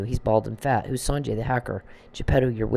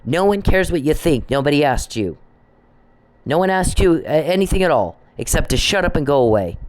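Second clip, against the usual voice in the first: angry tirade; 4 semitones higher than usual. Real, not acted. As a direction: keep hammering him.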